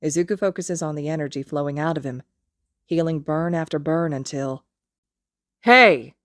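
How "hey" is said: sudden loud bark of a call; angry shout. Acted. Kacchan catching Izuku healing Haru, shouting. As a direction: cut in with a sharp yell.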